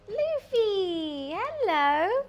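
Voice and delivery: high, loud, drawn-out call to a pet; singsong pet greeting. Real, not acted. Streamer calling out to the pet arriving on stream, singsong greeting. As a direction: sing out the hello in high swoops.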